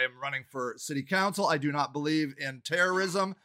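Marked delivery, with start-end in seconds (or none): none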